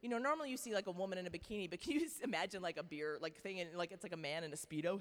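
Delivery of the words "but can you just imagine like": normal talking voice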